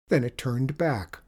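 ordinary delivery, nothing marked

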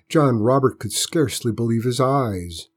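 usual speaking voice

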